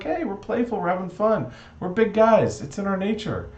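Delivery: high-pitched jolly protest; playful elephants. Acted. Elephants excuse their game of tag to Noah, playful.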